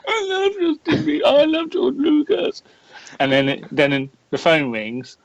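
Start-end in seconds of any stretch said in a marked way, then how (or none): 0.1-2.6 high, slow sobbing fan voice; hammed-up tearful fan; mock crying. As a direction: blubber it like the crying kid. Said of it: "put it against me".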